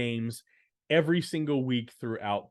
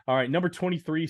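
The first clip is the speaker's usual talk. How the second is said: usual speaking voice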